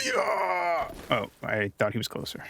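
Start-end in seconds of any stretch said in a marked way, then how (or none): 0.1-0.9 high shouted attack yell; battle cry. Pretend. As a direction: stretch out a high "hi-yah" as you strike. Battle cry during attack in game.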